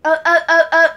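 loud chanted puppet grunts; goofy muffled sock puppet. Pretend. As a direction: bark out rhythmic loud uh sounds. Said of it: "I do math".

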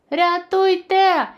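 long, high, loud cry for help; shouting for help. A narrator's reading. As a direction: call out for rescue. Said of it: "кричав Тімі".

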